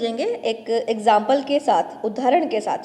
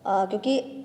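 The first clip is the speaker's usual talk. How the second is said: normal talking voice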